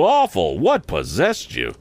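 high outburst, then slow disbelief; dismayed cry. A narrator's reading. Joe appalled at the boy's lies, crying out. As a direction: exclaim high, then stretch the question. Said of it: "cried Joe".

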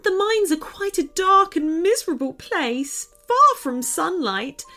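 put-on high, earnest girl's storytelling voice; upset little girl. Runaway girl explains the dragon to the friends, upset. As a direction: tell a woeful tale in a high little voice.

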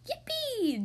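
high cheer; cheering yippee. Acted. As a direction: squeal yippee in delight. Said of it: "the other three shouted".

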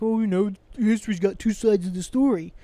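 a light mocking whiny voice for the other side; mocking sympathizer. Mimicking Nazi sympathizers he despises, lightly mocking. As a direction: sneer out their excuse, lightly voiced. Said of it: "trying to fucking sympathize with that shit".

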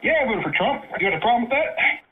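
high, challenging snap; defiant shout. Real, not acted. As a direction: throw it back defiantly. Defiant retort.

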